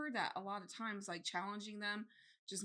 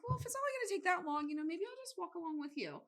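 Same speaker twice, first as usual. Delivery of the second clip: coaxing daughter; 7 semitones higher, faster than usual. Retold or imitated.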